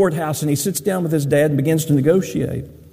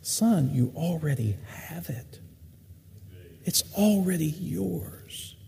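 soft, slow, hushed fatherly comfort; a father quietly reassuring his angry elder son, softly; tender reassurance; 11 dB quieter, slower than usual. Acted. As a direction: gently reassure a resentful son. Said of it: "and reassures him".

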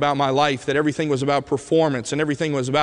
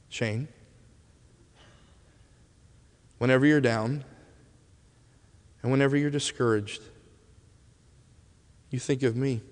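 tender farewell; 2 semitones lower, 6 dB quieter than usual. Retold or imitated. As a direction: give it like a precious last word. Dying boy's tender parting words.

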